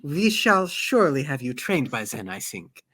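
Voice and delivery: put-on accented, measured praise from a mentor; courteous accented servant, precise and approving; formal steward with accent. Sazed praising his mistress's accent, formal and accented.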